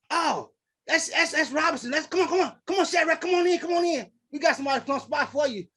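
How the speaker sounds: high, hurried, repeated beckoning; mock eager usher. Usher recognising a known guest, eagerly imitated.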